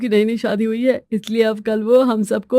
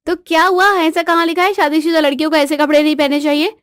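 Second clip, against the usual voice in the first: higher, indignant comeback; wife standing her ground; defiant wife; 5 semitones higher, 3 dB louder than usual. Acted. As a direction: argue back with raised pitch. Wife argues back about clothes.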